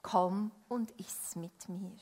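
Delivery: slow, soft, tender invitation; hushed storybook guest voice; gentle invitation. A narrator's reading. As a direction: invite quietly and kindly. Guest gently invites host to eat.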